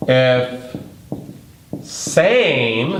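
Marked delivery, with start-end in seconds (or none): 1.8-2.9 "same" lifted high and held on its own; stress on same. Shown as a demonstration. Teacher stressing a key word for students, "same" emphasized. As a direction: lean hard on the word "same" with a rise. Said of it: "I wanna emphasize same".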